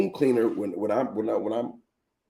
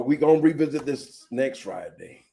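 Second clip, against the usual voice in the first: ordinary delivery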